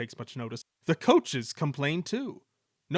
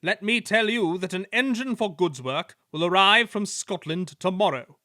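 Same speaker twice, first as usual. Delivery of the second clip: higher, louder, booming news; loud announcement; 6 semitones higher, 8 dB louder than usual. A narrator's reading. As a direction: proclaim it to the yard. Fat Controller announces news.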